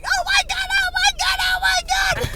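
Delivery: high-pitched retold panic shriek; panicked cry. Driver retelling gripping the wheel off-road, high panicked cry. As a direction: re-enact a terrified driver's repeated wail. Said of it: "gripping the steering wheel, going".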